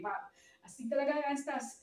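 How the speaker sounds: normal talking voice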